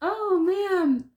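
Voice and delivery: loud high exclamation; surprised. Real, not acted. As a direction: caught off guard. Reacting to the card combo.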